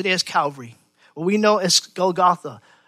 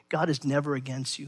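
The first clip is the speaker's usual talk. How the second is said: ordinary talk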